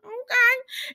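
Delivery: high, doubtful little reaction; puzzled reaction. Retold or imitated. Sonny and Nina looking at them, puzzled high reaction. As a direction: mimic their baffled look in a high voice. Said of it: "looking at them like".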